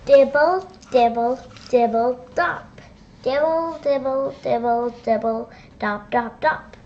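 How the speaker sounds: loud dripping rain sounds; drip-drop the rain, loudly. Pretend.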